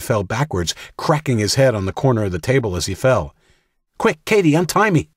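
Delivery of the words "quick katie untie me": much higher, louder urgent plea; urgent cry. A narrator's reading. Jack begging Katie to untie him, urgent high cry. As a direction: call out frantically to your sister.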